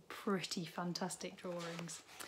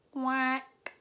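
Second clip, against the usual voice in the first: duck quack; 5 semitones higher, 6 dB louder than usual. A narrator's reading. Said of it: "The duck answered".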